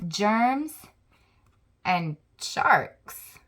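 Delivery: plain reading voice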